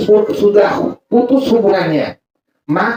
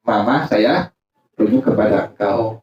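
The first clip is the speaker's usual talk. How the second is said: low, subdued husband voice; husband's declaration; 10 semitones lower, 4 dB quieter than usual. Acted. Husband declaring to his wife he takes her back, low and subdued. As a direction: drop low and soft for the husband. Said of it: "Laki bilang apa?".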